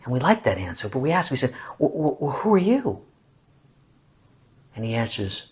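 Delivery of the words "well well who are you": high, halting wonder; tentative questioner. Acted. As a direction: stammer into a high, curious question. Voices our question to God.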